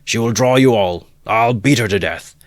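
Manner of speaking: loud, angry shout; furious threat. Acted. Mikulka threatening the mare, angry shout. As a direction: snarl out the threat.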